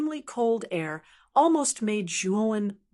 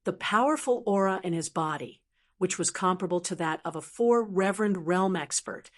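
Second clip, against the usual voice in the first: plain reading voice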